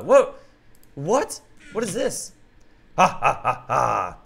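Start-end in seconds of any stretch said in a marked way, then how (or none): 3.0-4.2 slow, low evil laugh from a lion villain; villain lion's slow gloating laugh. Acted. Villain lion reveals himself.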